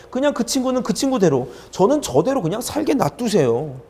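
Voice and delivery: high, loud incredulous objection; exasperated refusal, pitch swooping up; indignant protest. Retold or imitated. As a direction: argue back at God in disbelief. Acted protest back at God.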